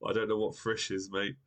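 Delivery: usual speaking voice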